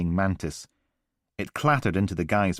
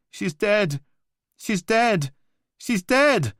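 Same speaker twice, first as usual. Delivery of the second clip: high, rising, louder repeated cry; horrified wail; 14 semitones higher, 5 dB louder, slower than usual. Acted. Caller on the recording realising she's dead, horrified. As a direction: break down in horror.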